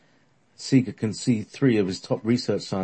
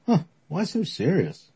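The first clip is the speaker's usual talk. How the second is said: teasing; 10 semitones higher than usual. Acted. Alfred teasing the serious crowd.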